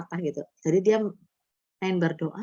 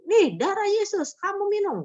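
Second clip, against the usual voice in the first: high-pitched, insistent command; imitated exorcist order; 13 semitones higher than usual. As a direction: act out the believer offering water, pitched way up. Retold exorcism, ordering victim to drink.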